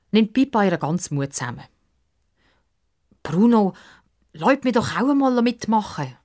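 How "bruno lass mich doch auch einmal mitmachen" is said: put-on high, pleading little-girl voice; begging girl. Little girl begging the boys to join, pleading. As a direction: plead with the boys to let her play. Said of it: "bettelt sie".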